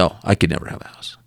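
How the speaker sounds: imitating her small whispered reply; meek, breathless whisper of disbelief; timid whisper. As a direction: whisper it like she can't imagine it. Timid woman voiced in story.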